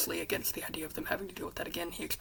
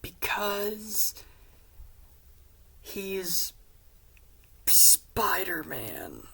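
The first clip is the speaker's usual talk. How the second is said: drawn-out, sighing confession; slow, heavy reveal with long pauses; reluctant admission; 3 semitones higher, much slower than usual. Retold or imitated. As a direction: drag it out as if forced to admit it. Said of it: "Peter sighs. He says".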